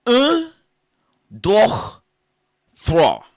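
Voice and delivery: high loud yelped count; mock French counting. Comic counting in French, high loud yelps. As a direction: squeal un deux trois high and loud.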